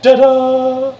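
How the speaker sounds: high loud sung chime; sung jingle. Shown as a demonstration. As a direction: sing the startup jingle.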